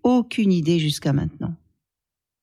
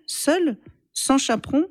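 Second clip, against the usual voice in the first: much higher, outraged disbelief; shocked; 9 semitones higher than usual. Acted. Father learning his daughter met a boy alone, shocked. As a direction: rise sharply in scandalised shock.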